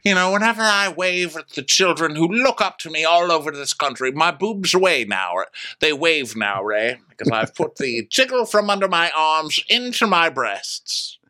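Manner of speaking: high, grandly theatrical lady; Karen. Retold or imitated. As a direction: declare it up high, stretching the final words. Host continues Karen impression.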